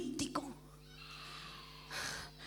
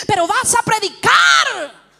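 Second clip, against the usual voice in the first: slow, high, thundering climax; shouted command; 6 semitones higher, 10 dB louder, slower than usual. Retold or imitated. God ending the argument, shouted command. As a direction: shout it as the final word.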